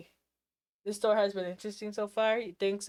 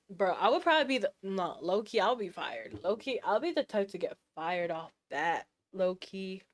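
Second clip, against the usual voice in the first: ordinary delivery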